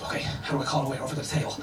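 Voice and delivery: hushed, hurried panic to himself; anxious muttering. Retold or imitated. Boyfriend unsure how to summon a waiter, anxious muttering. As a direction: think it through fast under your breath.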